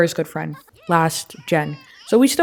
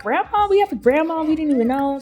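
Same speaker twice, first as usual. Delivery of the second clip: put-on excited kids; 9 semitones higher than usual. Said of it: "They're like".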